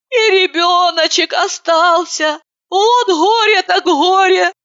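weepy gossip piling up grief; tearful lament. A narrator's reading. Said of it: "слезливо заключила Катерина".

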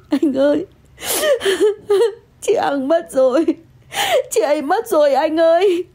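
high, grief-stricken crying; wailing sobs. Acted. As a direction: sob the words out to your partner. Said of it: "tôi òa lên khóc nức nở".

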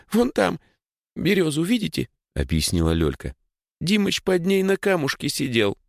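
put-on high, tearful little girl. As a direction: tell it like a shaken little girl. Little girl points the spot.

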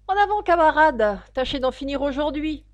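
high, loud rallying call; loud urging. A narrator's reading. Gryphon urges the Mock Turtle on, loud and high. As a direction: call out to hurry a comrade along.

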